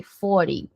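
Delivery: "forty" as fory, T reduced to a tap; FORTY, T not sounded. Shown as a demonstration. Teacher repeats forty after the rule, T dropped. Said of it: "ignora aqui o T".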